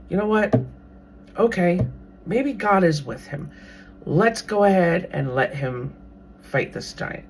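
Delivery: ordinary delivery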